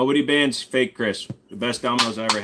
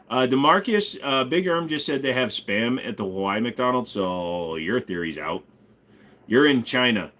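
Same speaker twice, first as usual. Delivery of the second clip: normal talking voice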